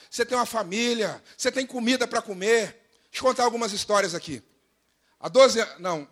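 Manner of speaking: ordinary talk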